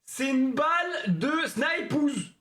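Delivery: slow, high hype voice; hyped slow pitch. Pretend. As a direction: sell it like a gun ad. (annonce lente et exaltée)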